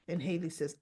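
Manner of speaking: ordinary talk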